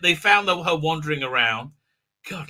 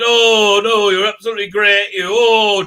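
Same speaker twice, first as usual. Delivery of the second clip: mock gushing; 3 semitones higher, 7 dB louder than usual. Mocking gushing praise.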